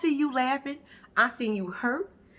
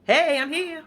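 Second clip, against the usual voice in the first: loud, higher call with a laugh; playful drop-in greeting; 6 semitones higher, 6 dB louder than usual. Pretend. Visitor popping up unannounced at a friend's house, playfully calling out. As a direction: announce yourself at the door, laughing.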